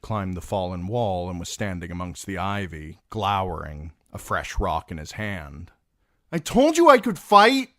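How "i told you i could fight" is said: put-on high, loud boyish shout; proud shout. Podrick shouting down from the wall, proud and loud. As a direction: call down proudly. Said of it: "he shouted down".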